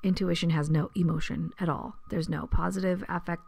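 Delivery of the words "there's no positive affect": usual speaking voice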